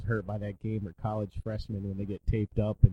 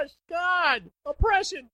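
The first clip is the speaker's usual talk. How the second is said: high mock whine; slower than usual. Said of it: "I said".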